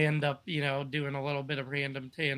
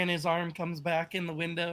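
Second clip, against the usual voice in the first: ordinary delivery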